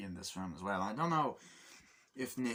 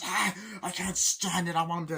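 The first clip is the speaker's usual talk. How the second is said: flipping out; 10 semitones higher, 8 dB louder than usual. Retold or imitated. Mother flipping out at her daughter, shrieking. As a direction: scream it like she's snapping, dragged out. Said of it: "the whole sequence where she just flips out".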